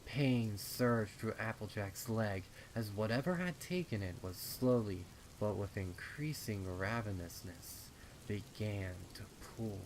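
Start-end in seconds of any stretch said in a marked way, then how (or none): none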